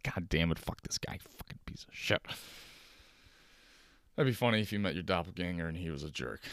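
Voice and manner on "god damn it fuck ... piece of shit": hushed, whispered mock resentment; cursing under breath. Bummed-out doppelganger cursing the lookalike under his breath. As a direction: mutter curses, fading into a whisper.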